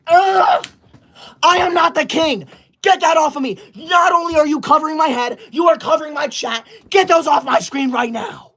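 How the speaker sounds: hammed-up outrage at the gifts; pretend-furious teacher shouting at the class; mock shout. Mock tantrum over cat-ear gifts.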